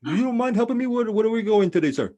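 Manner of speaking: high, loud put-on voice of a polite cab driver; Pakistani driver. Retelling the Uber driver asking him, in a high put-on voice. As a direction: play the nervous Uber driver asking directions. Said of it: "he's from fucking Pakistan".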